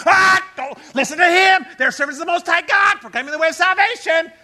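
high, loud hollered proclamation; crying out. Acted. Heckler butting into a workplace chat, crying out loudly. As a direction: holler it over everyone's conversation. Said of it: "they're crying out".

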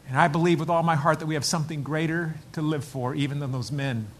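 ordinary talk, nothing marked

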